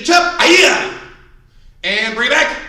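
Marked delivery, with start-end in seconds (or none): 0.0-0.4 high loud barked chop; shouted command. Shown as a demonstration. Instructor drilling students, loud shouted chop. 0.4-1.0 sharp high martial-arts yell; kiai shout. Shown as a demonstration. Instructor leading class drill, loud kiai shout.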